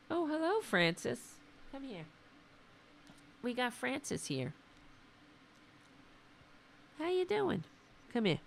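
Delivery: high, soft coaxing voice for a pet; pet talk. Real, not acted. Calling his pet Francis over during the stream, soft and high. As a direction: coo to the animal to come over.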